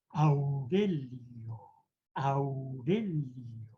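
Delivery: slow, drawn-out Aurelio with every vowel marked; vowel warm-up word. Shown as a demonstration. Speaker warming up the voice with Aurelio, slow and drawn out. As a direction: stretch each vowel of Aurelio slowly. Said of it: "la repetía varias veces esa palabra porque me hacía modular".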